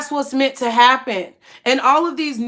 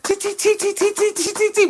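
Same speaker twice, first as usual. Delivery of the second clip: high mouth-made tambourine clicks; tambourine noise; 7 semitones higher than usual. Retold or imitated. Imitating church tambourines, high clicking sounds. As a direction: tap out the tambourine with your mouth. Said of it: "they be like".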